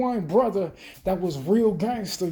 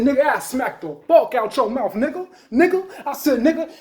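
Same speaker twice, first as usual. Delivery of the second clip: higher, louder heated yelling; retold yell; 4 semitones higher, 5 dB louder than usual. Retelling how he threatened the crybaby, rising yell. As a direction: yell the threat right in his face.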